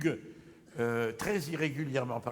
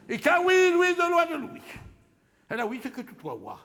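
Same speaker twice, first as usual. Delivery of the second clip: high, loud, triumphant nonsense; a loud, manic burst of comic punning; shouted wordplay; 11 semitones higher, 8 dB louder than usual. Acted.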